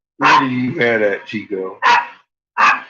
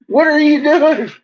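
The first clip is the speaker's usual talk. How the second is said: high, loud squeal at the dog; squealing dog talk; 9 dB louder than usual. Real, not acted. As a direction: shriek it at the dog in a sing-song.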